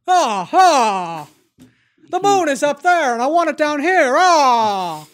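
loud, high movie-star impression; Jimmy Stewart. Actor unable to drop his voice for a director, loud impression. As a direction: belt it out with drawn-out hammy cries. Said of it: "went to Jimmy Stewart and was like".

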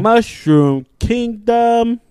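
high, loud, drawn-out movie-character line; Mario. Retold or imitated. Quoting the movie trailer to friends, mock-grand character voice. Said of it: "What did he say?".

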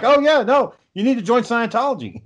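high, eager recruiter voice pushing the cult; Tom Cruise impression. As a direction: pitch it up like an overly keen salesman. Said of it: "Tom Cruise would. He'd be like".

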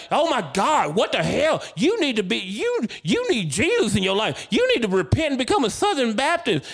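high, loud mock preaching outburst; hammed-up shrieking demand to repent; losing his shit. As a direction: imitate a nurse ranting in a high, loud voice. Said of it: "he lost his shit on me".

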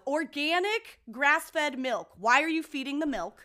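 outraged reading. Real, not acted.

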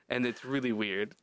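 ordinary talk

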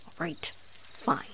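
slow, whispered giving in; whispered surrender. Acted. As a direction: breathe it out, defeated.

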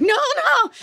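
high-pitched laughing "No, no"; flustered denial. Retold or imitated. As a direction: brush it off in a squeaky rush. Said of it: "And I was like".

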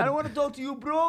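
high, tight Bradford voice; imitating a Bradford lad; Bradford accent. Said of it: "That's the Bradford accent".

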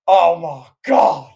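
loud, slow, drawn-out commentator exclamation; Jim Ross. Retold or imitated. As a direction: stretch out an announcer's horrified cry. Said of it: "the way he says that".

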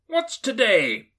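high, loud, slow call. A narrator's reading. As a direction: shout down to the street. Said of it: "cried Scrooge, calling downward".

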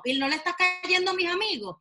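a hammed-up offended mother; mock mom complaining. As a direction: whine like the mom who feels left out. Mother voiced mocking daughter.

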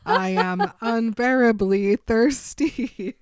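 loud, drawn-out declaration through laughter; hammed-up confession. Bella admitting thirst to Edward, hammed-up and loud. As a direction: declare it loudly and slowly, half laughing.

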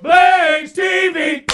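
slow, high drawn-out announcer shout; announcer call. Real, not acted. Host announcing the Blaze TV prize with a call. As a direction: call it out like a game show host.

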